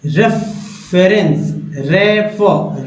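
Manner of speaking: रेफरेंस slowly split into रे-फ़र; slow syllables. Shown as a demonstration. Teacher sounding out रेफरेंस to students, slowly. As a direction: stretch out रेफर slowly. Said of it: "री पढ़ेंगे कि रे पढ़ेंगे".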